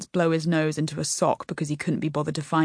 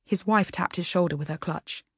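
usual speaking voice

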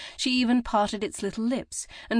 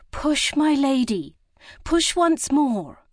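higher, louder and slow, urging her on; encouraging; 8 semitones higher, 6 dB louder, slower than usual. A narrator's reading. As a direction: drag out each word to push her on. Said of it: "Lucy encouraged".

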